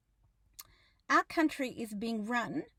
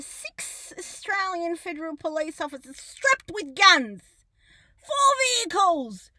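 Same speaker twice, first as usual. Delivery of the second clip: outraged emphasis; 5 semitones higher, 6 dB louder than usual. Real, not acted. Outraged listing of police force.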